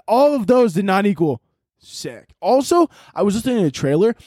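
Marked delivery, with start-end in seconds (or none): none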